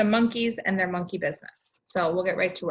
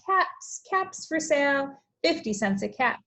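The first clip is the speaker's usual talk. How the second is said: peddler calling out; 6 semitones higher than usual. Acted. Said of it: "walked down the street calling".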